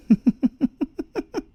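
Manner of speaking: high loud cackle; loud high chuckle; mocking laugh. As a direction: laugh at his confusion. Mocking the confused look.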